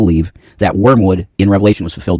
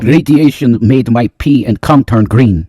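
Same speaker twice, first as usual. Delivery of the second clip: slow, put-on Russian accent; 3 semitones higher, 4 dB louder, much slower than usual. Joking to partner about radiation, slow Russian accent. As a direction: draw it out in a heavy mock-Russian accent. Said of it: "You like the Russian deal".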